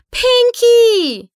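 "Pinkie!" screamed high and loud, falling; frantic scream. A narrator's reading. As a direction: cry out "Pinkie!" in panic. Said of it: "schrie Twilight".

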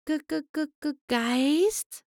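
high, hushed, stuttering fright; frightened stammer. A narrator's reading. The shy pony stammers at hearing of a ghost, frightened. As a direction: stammer out the word in fear. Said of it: "Das Pony wich ängstlich zurück".

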